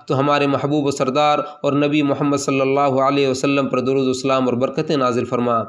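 usual speaking voice

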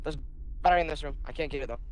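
ordinary talk, nothing marked

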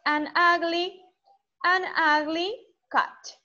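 "an ugly cat" spoken slowly, each word kept apart; slow it right down so kids can copy; slow model phrase. Shown as a demonstration. Teacher modelling slowly for students to repeat.

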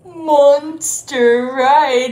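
loud cheerful monster; spring the answer on them; excited reveal. Shown as a demonstration. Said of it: "what is that?".

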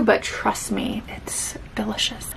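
slow, hushed whisper to the camera. Real, not acted. Confiding to her viewers that the odd dessert is good, whispered. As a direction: let them in on a secret in a whisper.

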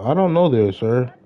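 ordinary talk

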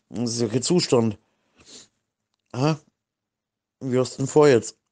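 ordinary talk

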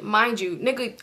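ordinary delivery

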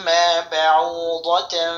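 slow বাউদাতান with a nasal hum on the nun; slow, nasalised demo; nasal ghunna. Shown as a demonstration. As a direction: hum the tanween through the nose. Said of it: "ইকফা গুণা".

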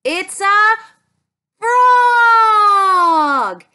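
loud joyful reveal; excited shout. Acted. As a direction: shout it out with delight.

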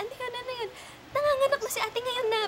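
high put-on mother voice; mother's voice. Mother on the phone announcing the labor, high voiced. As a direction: voice mother up high, matter-of-fact. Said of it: "Sabi niya".

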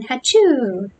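spelt-out fake sneeze, falling at the end; mock sneeze. A narrator's reading. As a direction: sneeze it as a word, hammed-up. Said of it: "Then again".

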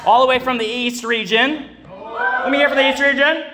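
loud, high rally call to each region; preacher hyping the congregation; calling out to the crowd. Real, not acted. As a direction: fire up the room, shouting.

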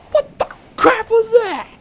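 loud, high disbelieving yelp; high shocked outburst. Real, not acted. Gamer yelling at a sudden attack, shocked and loud. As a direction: shout it in total disbelief.

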